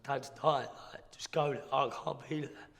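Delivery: high, loud, upset plea; distraught urgency. Retold or imitated. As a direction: plead down the phone, overcome. Retelling urgent call about dying father.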